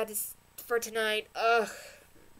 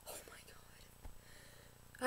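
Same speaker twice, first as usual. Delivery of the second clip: slow breathless whisper; 18 dB quieter, much slower than usual. Real, not acted. Still shaken by the episode, slow stunned whisper.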